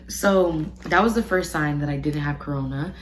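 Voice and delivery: normal talking voice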